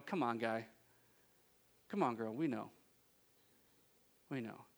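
low, quiet, unimpressed aside; knowing skepticism. Acted. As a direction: mutter it like an onlooker who isn't buying it.

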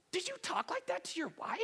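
high, incredulous comic question; mock disbelief. Viewer challenging the fake Southern talker, mock disbelief. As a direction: pitch it way up, teasing the put-on voice.

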